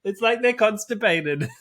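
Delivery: words squeezed out through laughter; laughing. Real, not acted. Laughing after the koala impression.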